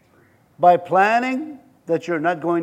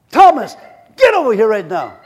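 Jesus calling Thomas over, mock anger; mock angry summons; 3 semitones higher, 9 dB louder than usual. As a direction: yell for Thomas like a fed-up boss. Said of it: "I'd be like this".

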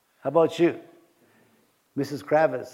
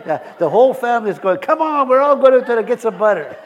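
much higher, louder, laughing through it; excited, laughing; 8 semitones higher, 11 dB louder than usual. Retold or imitated. Pastor voicing his family, excited to get butter. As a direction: hype up a trip for butter.

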